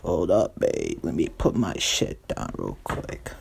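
stoned guy, words dragged out slowly; Pat. Acted. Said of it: "I'm so stoned".

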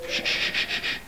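soft repeated shh; real shushing. Real, not acted. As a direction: hush them with quick, quiet shushes. Hushing someone mid-story.

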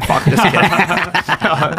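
laughing through a mock retort; chuckling, stretched out slowly. Imitating locals walking away.

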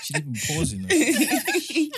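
high laughing remark; laughing while talking. Real, not acted. Laughing at the quick answer.

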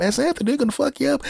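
friend tipping off the relatives; Texas friend. Retold or imitated. Friend calling relatives, high fast excited voice.

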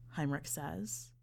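plain reading voice

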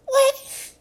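very high squeaky mock reply; squeaky what. The skinny jeans kid answering in a mock squeaky voice. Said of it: "He said".

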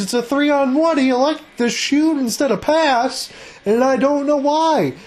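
baffled, animated hockey call; worked-up play-by-play. Real, not acted. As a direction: raise the pitch over a wasted chance. Questioning a wasted scoring chance.

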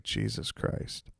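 whispered disbelief, drawn out; a stunned, whispered aside; muttered under breath. Retold or imitated. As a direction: breathe it out slowly, barely there. Stunned reaction to rude remark.

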